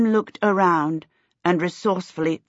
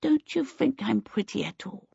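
put-on high, soft, wistful child question; wistful little boy; 4 semitones higher, 6 dB quieter than usual. A narrator's reading. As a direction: ask it small and wistful. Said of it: "Twinkle said wistfully".

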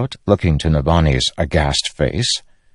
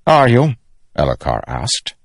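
high, raised doubting question; disbelieving, pitched-up young king; sceptical challenge; 9 semitones higher, 3 dB louder than usual. Acted. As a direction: throw the apology back at your uncle. Elhokar challenges the apology.

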